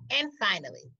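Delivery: normal talking voice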